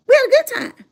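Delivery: delighted. Real, not acted. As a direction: relish the memory. Repeats with delight.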